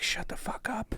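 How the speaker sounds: whispered, cutting retort; imitating a snapped whisper. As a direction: hiss it under the breath. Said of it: "finally just snapped on her".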